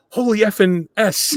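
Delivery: high, loud burst of disbelief through a laugh; stunned swearing. Retold or imitated. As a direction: blurt it out like a starstruck fan. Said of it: "I go".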